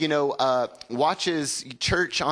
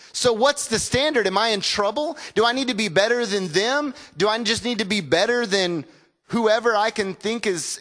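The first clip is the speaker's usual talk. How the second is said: rising intensity; 6 semitones higher than usual. Acted. Preacher builds rhetorical questions.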